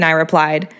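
ordinary delivery, nothing marked

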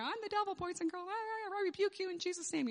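a hint of a hammed-up puppet devil. Puppet show dialogue with kids.

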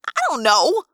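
loud frustrated yell; frustrated shout at friends. A narrator's reading. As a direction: shout it, fed up and scared. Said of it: "I yelled".

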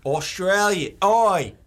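hammed-up Aussie; Australian accent. Shown as a demonstration. Showing off an accent to a friend, broad mock Australian. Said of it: "This is my Australian accent".

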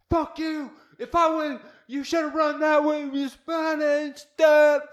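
high, loud, hammed-up drunk fan rant; mock screaming fan. Imitating fans screaming at football games, loud mock rant. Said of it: "constantly screaming and being like".

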